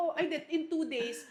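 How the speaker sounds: normal talking voice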